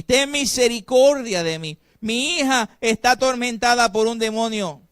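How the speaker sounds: loud, pleading cry of "Ten misericordia de mí". Shown as a demonstration. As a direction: call it out to the four winds, begging. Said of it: "Esta dama estaba gritando". (gritando a los cuatro vientos)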